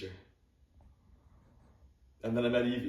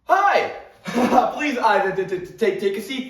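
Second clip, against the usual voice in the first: nervous loud greeting; 9 semitones higher, 7 dB louder than usual. Acted. Nervous teacher greets class loudly.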